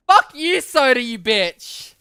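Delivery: loud high burst with a drawn-out finish; outraged shout. Real, not acted. Streamer cursing Soda for siding with friend, outraged shout. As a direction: lash out at the traitor, loudly. Viewer sides with heckling friend.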